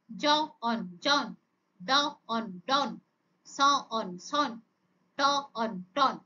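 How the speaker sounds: onset, rime, whole word, as in ড... অন... ডন; phonics sound-out. Shown as a demonstration. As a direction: say জ, pause, অন, pause, then blended জন. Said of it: "তার sound গুলোকে আমরা কি করব". (থেমে থেমে জ, অন, তারপর একসাথে জন)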